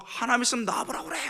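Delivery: high-pitched acted defiance; reenacted indignant challenge; defiant challenge.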